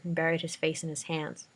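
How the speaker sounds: usual speaking voice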